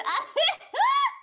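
high loud laugh; shrieking laugh. Real, not acted. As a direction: burst out laughing. Laughing at death.

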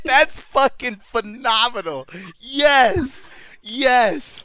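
high, loud thrilled praise; excited. Real, not acted. The speaker raving about the scene, high and loud. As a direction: gush over the scene. Delighted reaction.

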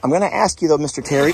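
ordinary talk

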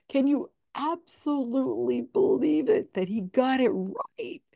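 high, incredulous sarcastic question; mock disbelief. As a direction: sneer at how easily he got it. Mocking disbelief at psychic.

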